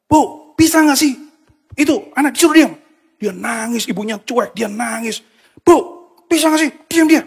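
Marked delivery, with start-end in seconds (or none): 0.1-2.7 loud, high-pitched irritated complaint; acting out an angry outburst, voice raised high; angry bus passenger. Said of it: "orang-orang semua di bis marah-marah". 5.6-7.2 loud, high-pitched irritated complaint; acting out an angry outburst, voice raised high; angry bus passenger. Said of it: "orang-orang semua di bis marah-marah".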